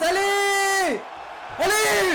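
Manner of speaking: high, loud cheering yell. Real, not acted. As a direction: scream it at the screen. Cheering the submission.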